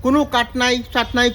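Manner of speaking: usual speaking voice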